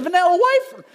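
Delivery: high Southern "wafer", said like "wyfer"; Southern accent. Retold or imitated. Son asking Dad for a snack, in a picked-up Southern accent. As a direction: imitate a kid's Southern twang, "wafer" rhyming with "lifer". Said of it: "It's not a wafer".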